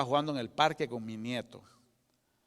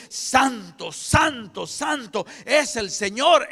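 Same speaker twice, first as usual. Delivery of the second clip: high ringing cry of holy; loud proclamation; 7 semitones higher, 7 dB louder, much slower than usual. Acted. Living beings crying out their worship of God, high and loud. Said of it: "no cesaban de cantar y decir".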